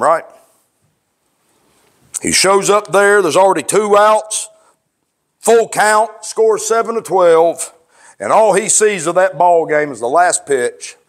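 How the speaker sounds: usual speaking voice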